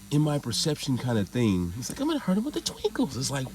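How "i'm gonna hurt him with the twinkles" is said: high, fast, mincing mock voice; mock girly voice. Narrator mocking a twinkly weapon's wielder, high girly put-on voice. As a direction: squeal it like a dainty fairy warrior.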